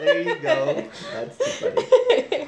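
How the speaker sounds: high laughing voice; laughing while talking. Real, not acted. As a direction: laugh as you speak. Host laughing hard.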